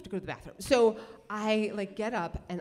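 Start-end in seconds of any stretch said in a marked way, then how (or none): none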